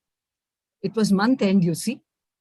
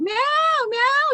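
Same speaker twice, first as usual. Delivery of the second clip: high mock meowing; cat meow; 14 semitones higher than usual. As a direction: squeak like a cat. Mother imitates the cat.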